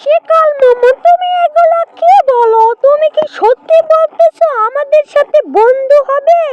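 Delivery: high-pitched rabbit character, eagerly questioning; surprised little rabbit. Acted. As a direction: play an astonished bunny, pitched high.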